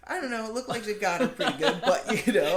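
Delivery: laughing while talking. Real, not acted. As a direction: chuckle through it. Laughing about the wound.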